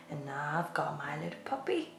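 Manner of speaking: 1 whisper. Acted. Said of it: "whispered aside to children".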